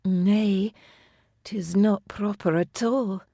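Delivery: slow, breathy moan. A narrator's reading. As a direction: let the words melt into a moan. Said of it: "Myra moaned".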